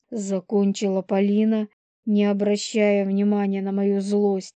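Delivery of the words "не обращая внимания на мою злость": usual speaking voice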